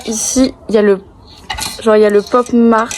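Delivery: ordinary talk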